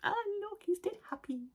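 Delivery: high, soft aww voice; cooing delight. Real, not acted. Showing viewers a happy dog card, high adoring coo. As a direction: melt over it in a high little voice.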